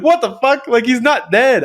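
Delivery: high-pitched mock protest; retold indignant outburst. Child insisting the dog is alive, mock indignation. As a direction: start high and protest as if replaying it.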